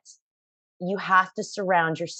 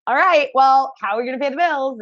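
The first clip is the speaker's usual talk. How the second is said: higher, louder, fast money panic; hammed-up worry over paying the bills; mock panic; 7 semitones higher, 6 dB louder than usual. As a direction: blurt it out in a fluster. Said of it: "all of a sudden it was like".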